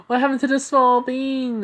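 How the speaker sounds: very high, loud squealed question; shocked wail. Acted. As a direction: wail it in disbelief. Katsumi shocked at changed Izuku.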